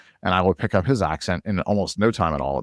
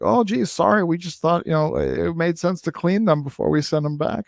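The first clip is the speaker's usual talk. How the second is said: mock-innocent excuse, pitched high; high-pitched, put-on "oops, sorry" tone; feigned apology; 10 semitones higher, slower than usual. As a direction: play innocent and sorry in a raised voice. Voicing sheepish excuse for wiping evidence.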